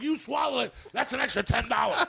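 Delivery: high mock yell of the pimp husband. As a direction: shout it like a greedy pimp. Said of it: "he's like".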